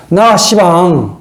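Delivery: slow, stretched Jeolla back talk; Jeolla dialect. Acted. Cain arguing with God, in drawn-out Jeolla dialect. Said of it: "전라도 버전으로 말하면".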